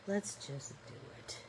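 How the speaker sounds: soft whisper to herself. Real, not acted. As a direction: let it slip out as a whisper. Repeats it to herself.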